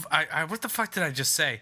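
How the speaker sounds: ordinary talk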